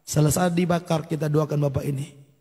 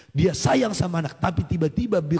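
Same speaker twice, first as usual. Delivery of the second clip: usual speaking voice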